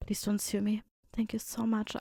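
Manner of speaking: soft, whispered mock plea; hushed plea. Host jokingly begging not to be sued, hushed. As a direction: drop to a whispered aside.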